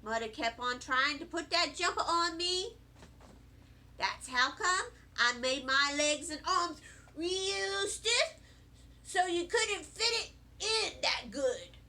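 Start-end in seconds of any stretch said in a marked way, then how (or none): none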